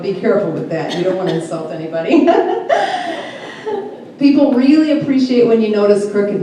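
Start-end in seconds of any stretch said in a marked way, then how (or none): 2.3-4.1 high laugh. Real, not acted. Laughing after warning not to insult.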